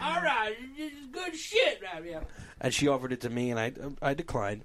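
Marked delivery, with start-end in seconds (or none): 0.0-2.2 high put-on lady's voice; imitated ferry lady. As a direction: mimic her in a high-pitched caricature. Voices the woman offering food.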